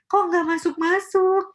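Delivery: high, loud, slow exasperated whine; mock exasperated learner, dragged out and high; frustrated whine. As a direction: whine at yourself like nothing sticks.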